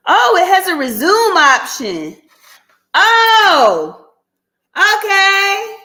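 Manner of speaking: delighted little discovery; pleased surprise. Real, not acted. Surprised phone can resume.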